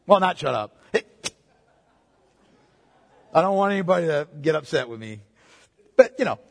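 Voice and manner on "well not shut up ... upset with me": normal talking voice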